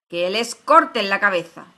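very loud royal command; full-voiced, merciless shout; shouted sentence. A narrator's reading. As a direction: bellow the order to execute. Queen repeats her shouted death sentence.

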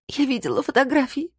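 very high-pitched, lightly acted tearful voice. A narrator's reading. Young woman accuses the man over photographs, tearful. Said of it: "заплакала Ясмин".

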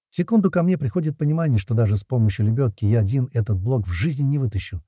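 usual speaking voice

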